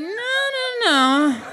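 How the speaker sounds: hammed-up God overruling the angels; God insisting in a high voice; insistent God. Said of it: "And God was like".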